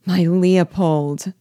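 slow, drawn-out joyful exclamation; a lingering, fond cry; exclaiming. A narrator's reading. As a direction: draw the name out with mild delight. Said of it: "she exclaimed".

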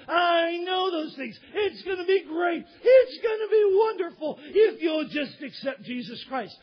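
high, gushing promise with stretched words; overblown enthusiasm. Acted. Preacher imitating promises of a wonderful life, overblown enthusiasm. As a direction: oversell it with mock rapture.

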